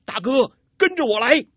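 high, loud call across the fight; calling loudly. A narrator's reading. Yang Tiexin shouts to his sworn brother during the fight, loud call. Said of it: "高声叫道".

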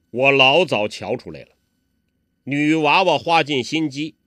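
put-on a loud, booming old master teasing the kids; hearty old beggar. Hong Qigong booming at the two youngsters, a loud jolly old beggar.

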